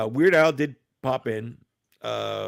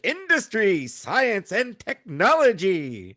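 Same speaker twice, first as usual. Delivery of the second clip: announcer voice; 9 semitones higher, 5 dB louder, slower than usual. Retold or imitated. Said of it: "the M S T three K".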